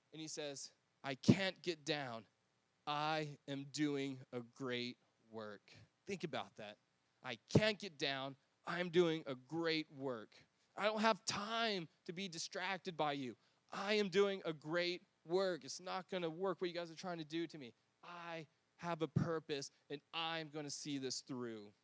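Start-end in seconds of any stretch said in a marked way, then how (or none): 1.0-5.7 slow, steady, resolute declaration; firm and slow. Acted. Nehemiah answers his mockers, slow and resolute. 7.2-21.7 slow deliberate resolve; firm and slow. Acted. Nehemiah refuses the enemies' taunts, slow and firm.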